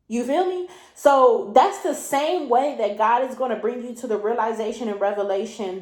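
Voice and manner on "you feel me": normal talking voice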